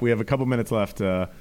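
normal talking voice

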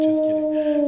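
high-pitched playful backpedal. Real, not acted. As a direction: repeat it high and teasing.

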